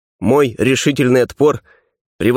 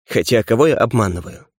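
ordinary delivery

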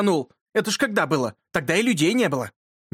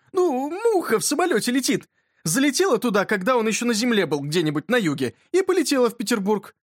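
higher-pitched, lightly voiced boy explaining; insistent boy; 8 semitones higher than usual. A narrator's reading. Yurka explains the fly to his friend, insistent boy. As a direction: explain it eagerly in a boy's raised pitch.

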